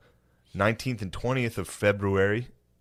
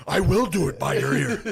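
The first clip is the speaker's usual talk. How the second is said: higher, louder, laughing taunt; louder, higher laughing shout; playful mock yell through laughter; 9 semitones higher, 4 dB louder than usual. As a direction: tease him again while cracking up. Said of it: "He starts yelling".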